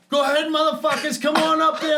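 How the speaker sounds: high, loud shouted dare; drunk taunt. Retold or imitated. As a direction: dare them to climb up. Taunting firemen from roof.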